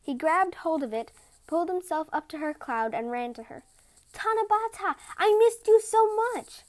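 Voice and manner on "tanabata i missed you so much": high, loud, overjoyed; joyful reunion. Acted. Mirekan reaching his wife at last, joyful and loud. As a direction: pour out the longing.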